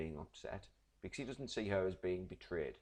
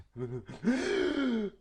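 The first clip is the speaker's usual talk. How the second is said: high, loud gasp for air; sharp crying gasp; 8 dB louder than usual. Shown as a demonstration. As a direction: gasp like you're about to suffocate. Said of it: "and you do that".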